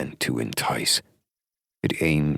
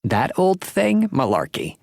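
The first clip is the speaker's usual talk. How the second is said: put-on very high, loud dismissive old woman; scoffing old lady; 13 semitones higher, 5 dB louder than usual. A narrator's reading. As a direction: scoff it off, elderly. Thelma scoffs.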